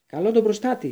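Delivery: high, loud spell cry; thundering shout. A narrator's reading. Harry casting at the boggart, thundering shout. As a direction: roar the spell out. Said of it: "φώναξε με βροντερή φωνή".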